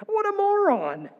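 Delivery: high, laughing scorn at the fool; mocking scorn. Preacher laughing at the fool who counsels God, mocking scorn. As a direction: scoff at him with a laugh.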